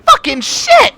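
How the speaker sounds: high, loud panicked cry; panicked outburst. Retold or imitated. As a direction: blurt it out in sudden panic. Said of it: "I was freaking the fuck out".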